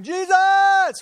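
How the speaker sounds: loud desperate cry; calling out. Retold or imitated. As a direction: shout the name across the crowd. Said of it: "he's going".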